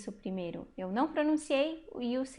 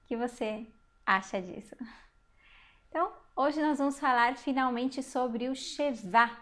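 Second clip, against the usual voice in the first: normal talking voice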